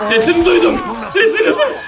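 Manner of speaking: high excited alarm; alarmed squeak. Real, not acted. Repeat pitched up in alarm.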